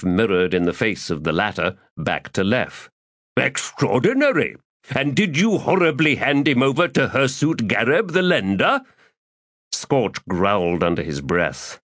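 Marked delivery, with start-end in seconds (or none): 3.4-8.8 florid, theatrical gentleman rogue; lofty, self-pleased orator; pompous Crupper voice. Acted. Crupper reacts.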